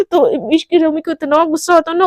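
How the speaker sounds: usual speaking voice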